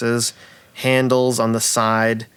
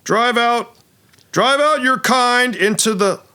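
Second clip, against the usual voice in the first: high, hammy battle proclamation; heroic dwarf actor; 11 semitones higher, 3 dB louder than usual. Acted. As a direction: declaim it like a stage paladin. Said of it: "actor says".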